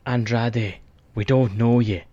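a rough-and-ready Geordie accent, hammed up like a wrestler's promo; imitating Pac with a put-on Geordie accent, half serious. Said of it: "Apologies for my Geordie accent".

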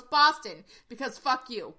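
loud, blunt punchline; brash Boston retort. Retold or imitated. Host reading a meme about Boston to co-host, brash retort. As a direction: hit the city name hard, then toss off the insult. Reads meme's rude punchline.

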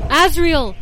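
mother calling her son back. A narrator's reading. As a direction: call after your son as he flies off. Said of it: "his mother call out".

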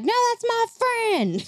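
high, tiny otter rescuer, laughing; squeaky otter voice. Pretend. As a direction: play a cute heroic otter.

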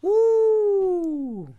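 loud, very high whoop of delight; excited whoop. Real, not acted. Cheering the guy's real response, loud high whoop. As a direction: let out a big cheer. Reacting to a touching story.

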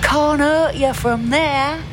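high, slow put-on voice for the father; father's voice. A narrator's reading. Father figure voiced in story.

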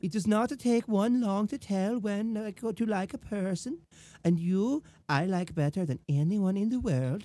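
put-on lightly girly love-letter reading in an accent; measured, accented mock-romantic woman; Belle. Woman writing to her suitor, mock-romantic accented voice. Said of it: "I'll read it in Belle's voice".

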